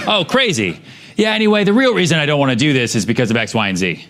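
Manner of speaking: high, breezy customer brushing it off; mock customer voice. Customer chatting to salesman, lightly voiced. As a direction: play the customer casually moving on.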